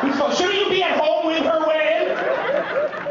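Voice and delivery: high, loud scolding with a laugh; playful telling-off; mock scolding shout. Real, not acted. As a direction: tell him off loudly, half laughing. Scolding audience member.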